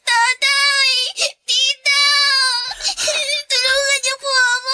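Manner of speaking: tearful begging. Acted. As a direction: beg them to stop through tears. Girl begs not to be hit.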